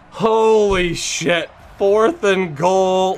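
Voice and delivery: drawn-out awe at the last-second score; awestruck. Real, not acted. Amazed after scoring.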